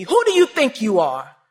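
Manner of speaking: loud, stern challenge; father's angry demand. Retold or imitated. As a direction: bark it like an outraged father. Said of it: "He said to me".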